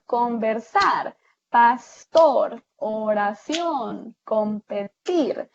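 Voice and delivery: slow list, "conversar, pastor, oración, competir", each ending stretched; stress on final syllables. Shown as a demonstration. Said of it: "tienen el acento al final". ("conversar, pastor, oración, competir", lento y alargando la última sílaba)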